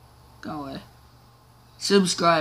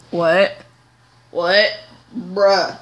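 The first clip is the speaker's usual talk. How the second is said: loud disbelief; 5 dB louder, much slower than usual. Real, not acted. Disbelief at game.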